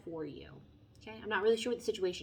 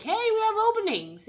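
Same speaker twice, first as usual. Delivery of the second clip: higher, louder eager caller; 11 semitones higher, 11 dB louder than usual. Retold or imitated. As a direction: cheerfully announce the job openings. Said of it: "Brahms was like".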